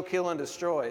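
usual speaking voice

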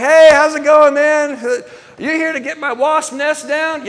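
high, loud retold hello; cheery greeting. Man hails the visiting serviceman, cheery greeting.

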